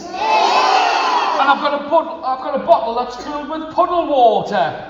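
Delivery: high, loud protest about the bottle; telling the old man what's in the bag, loud and high; exasperated shout. Acted. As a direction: shout it out as a fed-up girl. Girl complains about her food.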